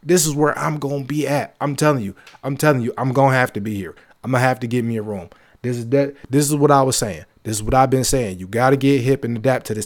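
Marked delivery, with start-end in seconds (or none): none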